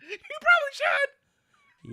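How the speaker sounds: slow, very high mocking yell; hammed-up high-pitched imitation; exaggerated mimicking shriek. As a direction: screech it out, drawn out and loud. Repeats the shrieked mock imitation.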